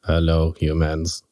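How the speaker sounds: low, stiff robot voice. Pretend. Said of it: "he can do the, uh, robot voice better than me".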